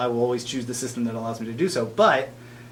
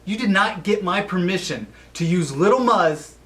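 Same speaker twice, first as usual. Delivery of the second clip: mock outrage; 6 semitones higher than usual. Indignant rant at Naughty Dog.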